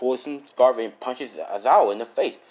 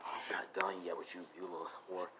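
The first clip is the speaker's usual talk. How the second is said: low whispered threat; hushed, low and very soft; quiet menace; 5 semitones lower, 19 dB quieter than usual. Acted. One character to her after the breakup, whispered menace.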